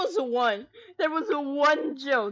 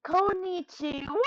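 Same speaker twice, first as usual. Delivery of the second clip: put-on drawn-out teen greeting, slow; a hint of a cringey teen yelling hello; teenage Sonic; 5 semitones higher than usual. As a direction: lightly mimic a teenage cartoon hedgehog. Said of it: "in the most teenage I've ever heard".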